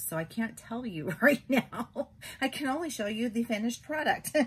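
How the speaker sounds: talking through a real giggle; laughing. Real, not acted. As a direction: chuckle while hiding the surprise. Laughing while keeping a secret.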